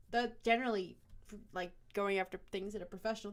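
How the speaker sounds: ordinary talk, nothing marked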